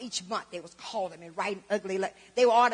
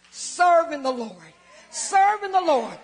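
loud, slow, high shouted praise; hollering praise; 4 semitones higher, 8 dB louder, slower than usual. Retold or imitated. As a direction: holler it out to the Lord. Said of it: "just hollered as best I could".

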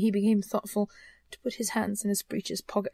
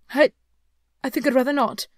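nervous hesitation; 4 semitones higher, 7 dB louder than usual. Acted. Scrooge hesitates in fear.

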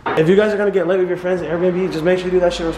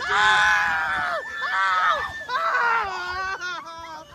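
ride screams; 12 semitones higher, 7 dB quieter, much slower than usual. Real, not acted.

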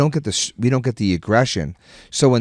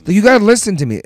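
high, louder exasperated insistence; retold dad scolding; 10 semitones higher, 6 dB louder, faster than usual. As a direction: insist that the kid listen, fed up. Dad scolding son, continued.

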